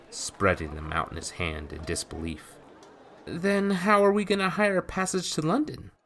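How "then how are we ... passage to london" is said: put-on much higher, fast boyish voice; a startled lad blurting out; young boy Jacques. A narrator's reading.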